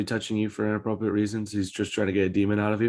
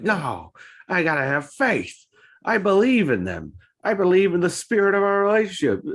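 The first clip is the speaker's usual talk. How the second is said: naive believer; 8 semitones higher than usual. Retold or imitated. Naive partner voiced in story.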